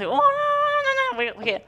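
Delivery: high-pitched wailing refusal; mock crying. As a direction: imitate a child's rapid tearful protest. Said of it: "Gue nangis".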